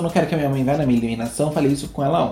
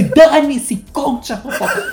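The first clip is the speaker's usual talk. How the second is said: impatient gossip demand; 10 semitones higher, much slower than usual. Retold or imitated. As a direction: demand the gossip, much higher. Acted gossip-hungry reply. (exigência agitada e aguda)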